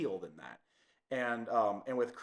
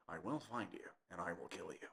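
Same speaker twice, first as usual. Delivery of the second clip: low, hushed menacing threat; Liam Neeson; 6 semitones lower, 14 dB quieter than usual. Retold or imitated. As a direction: drop low and soft, quietly intimidating movie-hero impression. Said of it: "doing a little bit of Liam, Liam Neeson".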